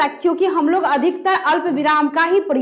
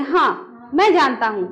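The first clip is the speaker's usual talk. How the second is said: lower-pitched हाँ, break, मैं जानता हूँ; pause after हाँ; 3 semitones lower, slower than usual. Shown as a demonstration. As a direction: let हाँ stand alone before going on. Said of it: "कैसे बोलेंगे?". (हाँ पर रुककर आगे बोलें)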